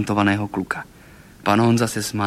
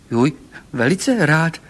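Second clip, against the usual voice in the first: higher-pitched delighted burst; blurted enthusiasm; 5 semitones higher, 3 dB louder, slower than usual. A narrator's reading. Golfík answering the children's invitation, excited outburst. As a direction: burst out eagerly as the little car. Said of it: "vyhrkl nadšeně Golfík".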